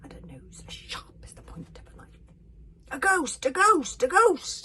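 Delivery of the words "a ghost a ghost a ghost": loud terrified scream; three rising screams of fright. A narrator's reading. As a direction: yell it in panic. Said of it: "screamed the Vikings".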